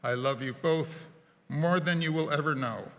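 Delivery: usual speaking voice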